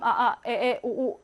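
"아, 아, 에, 에, 오, 오" as separate short vowels; robotic doubled vowels, each said on its own. Shown as a demonstration. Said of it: "로보트처럼".

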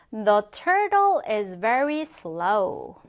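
very slow, spaced-out example sentence; slow model reading. Shown as a demonstration. Teacher modelling a turtle example for students, slow model reading. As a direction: stretch each word out for learners.

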